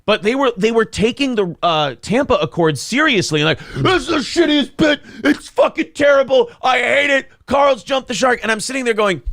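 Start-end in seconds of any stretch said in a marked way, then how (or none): 3.8-8.4 mock hater rant. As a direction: ham up an outraged online critic. Mocking haters complain about bit.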